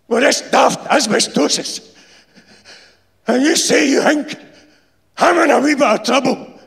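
loud, high furious shouting; in a rage. Acted. Insulator raging at the foreman with a knife at his throat, furious shout. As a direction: roar it at him, blade at his throat. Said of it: "The insulator speaks in a rage".

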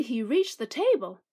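plain reading voice

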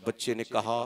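ordinary talk

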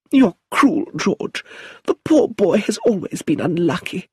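aunt frets over her son's telegram; anxious old aunt. Acted. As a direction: plead as a worried mother. Aunt Lucilla's voice.

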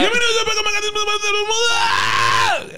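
high shouted show intro; lucha libre announcer. Acted. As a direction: bellow the welcome like a ring announcer.

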